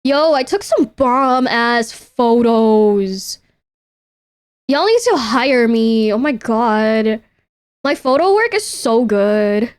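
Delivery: slow, drawn-out show-off boast; hammed-up cocky brag; mock bragging. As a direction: lay the swagger on thick, slowly. Bragging about own photos.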